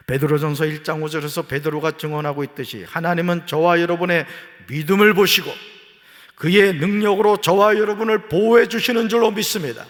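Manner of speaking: loud, high pulpit preaching; fervent preaching. Real, not acted. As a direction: drive it home to the congregation. Preaching at full force.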